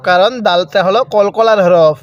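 normal talking voice

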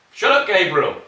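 loud, high telling-off; real shout. Real, not acted. Parent to noisy kid mid-recording, shouting.

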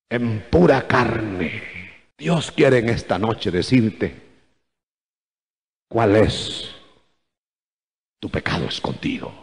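low, soft, solemn preaching; grave, hushed warning. Real, not acted. Preacher confronts congregation about hidden sin, hushed and grave.

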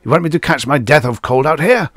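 high, loud, impatient command; ordering. A narrator's reading. General hurrying Adams up the stairs, ordering. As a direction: hurry him in out of the cold. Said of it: "the general ordered, shivering".

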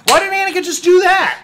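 high, loud outraged question; mock outrage. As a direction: ham up the indignation.

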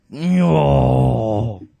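loud, long, anguished no; brute's loud no. Acted. Bartray overwhelmed by hard words, loud wail. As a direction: howl the no like a pained brute.

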